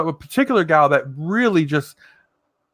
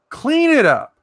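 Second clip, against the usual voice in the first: much higher, forceful repeat; emphatic order; 10 semitones higher, 4 dB louder than usual. Shown as a demonstration. Ordering Mama Beth into the shower, emphatic high-pitched command. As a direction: push CLEAN IT UP high and hard.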